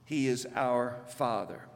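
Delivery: ordinary delivery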